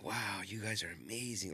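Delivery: a soft, voiceless whisper of admiration; whispered praise. Retold or imitated. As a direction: breathe it out like a loving guru. Retelling the guru's loving praise.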